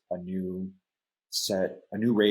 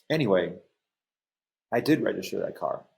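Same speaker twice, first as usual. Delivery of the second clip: ordinary delivery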